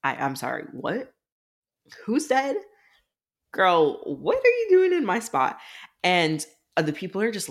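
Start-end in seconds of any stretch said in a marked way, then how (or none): 0.0-5.6 incredulous. Retold or imitated. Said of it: "Melina's like".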